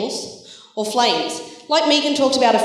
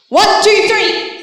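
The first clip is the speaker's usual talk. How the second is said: higher, louder count-in with the second number punched; excited count; 8 semitones higher, 5 dB louder, slower than usual. Shown as a demonstration. Leader counting in the children with extra excitement. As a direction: rev the kids up for cuter bleats. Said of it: "Can you be even more adorable?".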